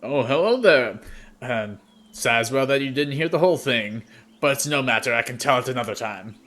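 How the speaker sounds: a friendly warrior hailing strangers by the fire; hearty warrior greeting. Acted. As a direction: greet the newcomers with a big booming hello. GM voices genasi greeting.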